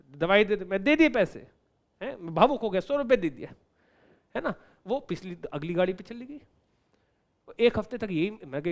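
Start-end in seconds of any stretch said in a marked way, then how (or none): none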